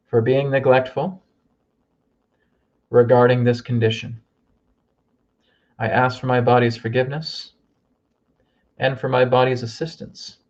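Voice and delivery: leading the group in an apologetic affirmation; affirmation to repeat. Shown as a demonstration. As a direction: say each phrase so they can echo it. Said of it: "repeat after me".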